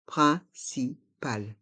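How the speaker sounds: "Principal" split slowly into three syllables; slow model pronunciation. Shown as a demonstration. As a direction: pause between syllables, clear nasal in of "Principal". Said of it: "Il faut bien prononcer".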